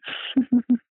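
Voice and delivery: quick nervous giggle; uneasy little laugh. Real, not acted. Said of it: "You do a nervous laugh thing".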